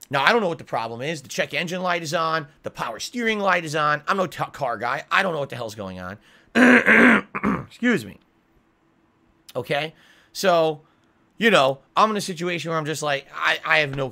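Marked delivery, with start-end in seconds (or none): none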